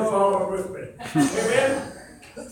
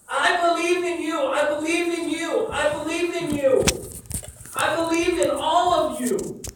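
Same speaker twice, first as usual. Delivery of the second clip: fervent, building preacher voice, often louder; preaching at full force; 5 semitones higher, 6 dB louder than usual. Acted. Pastor urging his congregation, at full preaching force. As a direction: preach it, pressing every line home.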